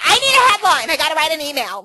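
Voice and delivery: high, loud mock impression of Kathy; squeaky over-the-top take on Kathy's voice; imitating Kathy. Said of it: "she didn't sound like that".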